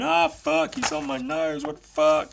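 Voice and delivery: high-pitched mock cry of alarm; panicking giant shark. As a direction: wail like a shark that's just been bitten. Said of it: "he's got the giant shark going".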